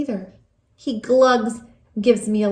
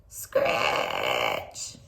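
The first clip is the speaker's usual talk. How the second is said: spooky screech; 9 dB quieter than usual. Acted. Spooky night noise.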